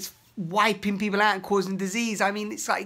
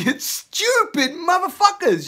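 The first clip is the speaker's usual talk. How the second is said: higher, louder, slow insult; contemptuous rage; 12 semitones higher, 7 dB louder than usual. Pretend. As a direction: spit the insult at them. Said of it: "That's the energy that's inside".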